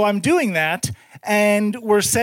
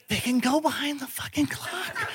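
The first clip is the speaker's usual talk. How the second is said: soft, stunned murmur; hushed dread; 3 semitones higher, 9 dB quieter than usual. Retold or imitated. Discovering roaches behind the ikea clock, hushed dread. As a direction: let it drop in awe.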